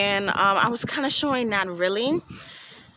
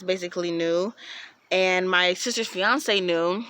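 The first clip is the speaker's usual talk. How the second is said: ordinary talk, nothing marked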